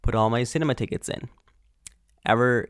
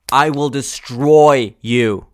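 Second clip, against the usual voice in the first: slow, louder threat; mock threat; 6 dB louder, slower than usual. Real, not acted. Podcaster warning cousins who mumble on phones, slow mock threat. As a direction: threaten slowly and forcefully.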